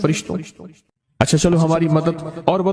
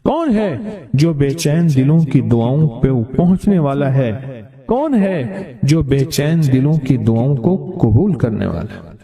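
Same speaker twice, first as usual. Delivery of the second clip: solemn preaching; 3 semitones lower than usual. Acted. Verse meaning, repeated with music.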